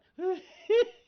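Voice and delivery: loud high burst of laughter; sudden high laughing burst. Real, not acted. Laughs about yelling at God.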